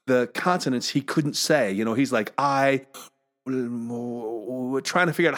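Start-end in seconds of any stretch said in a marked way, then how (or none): 2.4-5.4 "I" then a slow, garbled "wuh" groping for the L; struggling to form L. Retold or imitated. Four-year-old trying to say consonants, struggling after "I". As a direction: stall after "I", mouthing W sounds. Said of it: "trying to make his mouth say".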